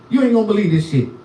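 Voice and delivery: ordinary delivery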